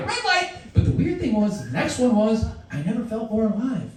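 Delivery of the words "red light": high, sharp game call; called-out command. Pretend. Calling a game of red light green light, high call. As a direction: announce it like a playground game.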